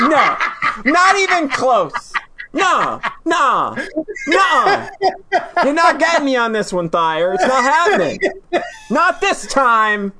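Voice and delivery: high, laughing, emphatic refusal; amused, high-pitched protest; laughing denial. Real, not acted. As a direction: refuse adamantly through laughter. Laughing denial of the pirate comparison.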